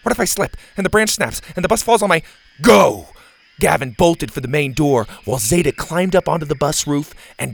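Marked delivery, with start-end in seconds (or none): 0.0-2.2 nervous babble. Acted. Bo nervously babbling before the charge. 2.6-3.1 loud barked go; a loud go call; sharp command. Acted. Gavin starts the charge.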